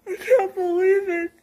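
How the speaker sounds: real happy crying, barely holding it together; tearful disbelief. Real, not acted. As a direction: sob out the disbelief with joy. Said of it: "just give me a minute".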